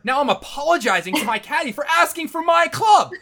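loud, high-pitched exasperation; indignant outburst. Real, not acted. Golfer telling friends how he apologized to his caddy, indignant. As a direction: raise pitch and volume in comic outrage.

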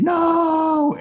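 high, loud mock scream of no. Two neighbors scream at the bone, high loud mock scream. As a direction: shriek it like a horror victim. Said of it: "they both simultaneously scream".

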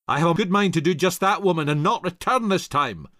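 fast, high, loud angry husband; angry retort. Acted. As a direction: fire it back at her quickly. Husband snaps back in quarrel.

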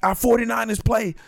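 giddy football dad; excited dad. Retold or imitated. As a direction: pump it up like a happy fan. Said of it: "I was like".

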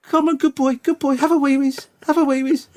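very high sweet coaxing; high gentle praise and coaxing; puppy talk. Shown as a demonstration. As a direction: coax the dog sweetly. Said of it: "How's that?".

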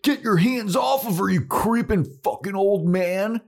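high, loud hammed-up outrage; outraged mock yell. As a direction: yell it as an indignant crew of kids. Crew yelling at old man.

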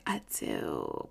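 very low, soft mumbled reply; subdued vow. Retold or imitated. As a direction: drop way down and barely say it. Retold vow, dropped very low.

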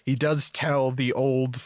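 ordinary talk, nothing marked

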